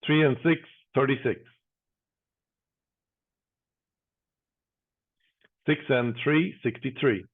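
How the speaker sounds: steady sing-song number calling; bingo caller. Acted. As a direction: call each number out, then repeat it.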